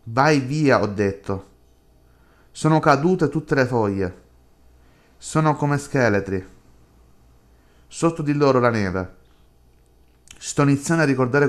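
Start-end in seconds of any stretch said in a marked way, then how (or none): none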